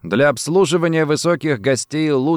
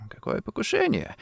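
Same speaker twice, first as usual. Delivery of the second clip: high, soft stammering question; faint voice, weak with fear, pitched way up; frightened babble; 11 semitones higher, 9 dB quieter than usual. A narrator's reading. Rossetti questioned about the assassination, babbling faintly. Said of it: "пролепетал белыми губами Рассетти".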